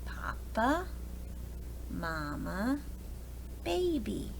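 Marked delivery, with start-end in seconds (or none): none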